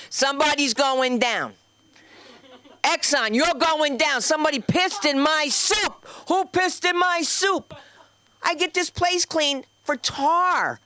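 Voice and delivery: high, slow indignant yelling; mock fury over the soup; outraged rant. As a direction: rage like a Jersey mom wronged. Escalating Jersey outrage rant.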